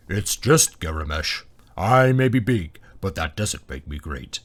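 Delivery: slow, deep dragon voice; ponderous, low-pitched beast; booming dragon. A narrator's reading. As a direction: boom it out slowly as a huge dragon. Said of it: "the dragon boomed".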